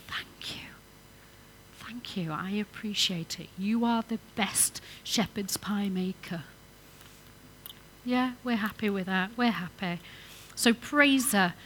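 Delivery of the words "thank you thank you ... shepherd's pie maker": soft whispered thanks. Shown as a demonstration. Preacher showing husbands how to thank wife, whispered. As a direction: breathe the thanks quietly.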